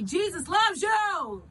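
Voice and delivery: high, slow shout; loud proclamation. Shown as a demonstration. Showing viewers what to say in public, loud and proud. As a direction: call it out loud, stretching the words. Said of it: "and say it loud".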